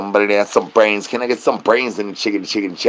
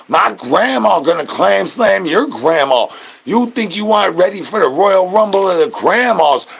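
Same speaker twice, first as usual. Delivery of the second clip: hype trash talk; 8 semitones higher than usual. Acted. Hype trash talk about grandmas.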